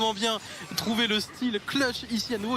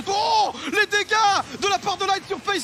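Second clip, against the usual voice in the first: much higher, louder amazed call; excited shout; 11 semitones higher, 6 dB louder than usual. Acted. Co-caster hyped call.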